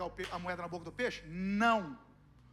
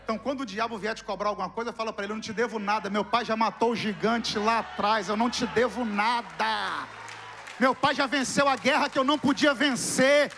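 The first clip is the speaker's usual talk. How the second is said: louder and louder, slow defiant preaching; preaching at full force; 13 dB louder, slower than usual. Acted. Preacher urging the congregation to defy the devil, rising to a roar.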